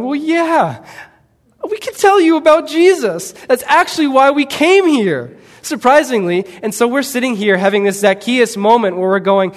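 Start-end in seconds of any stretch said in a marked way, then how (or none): 0.0-5.4 much higher and louder, bursting with eager delight; eager excitement. Retold or imitated. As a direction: act out the stunned, thrilled reply. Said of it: "imagine us sitting here going".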